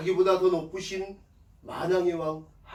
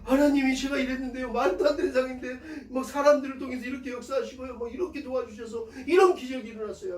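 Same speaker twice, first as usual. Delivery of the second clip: higher, lightly voiced excited believer; giving testimony; 6 semitones higher than usual. Believer tells others of God's help, eager higher-pitched testimony. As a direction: pour out a grateful testimony in a higher voice. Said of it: "간증을 하게 해주고".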